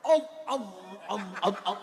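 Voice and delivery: repeated throat-noise "om, om"; mock fussy takbir noises. Self-important worshipper disturbing others at takbir, mock repeated grunts. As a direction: stutter out short intrusive noises. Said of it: "Mulai takbir dia ganggu sudah kita".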